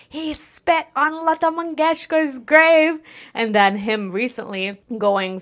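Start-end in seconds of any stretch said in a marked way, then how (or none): 0.1-3.0 higher, slow mock outrage; outraged accuser. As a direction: play an indignant troll, hammed up. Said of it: "everyone being like".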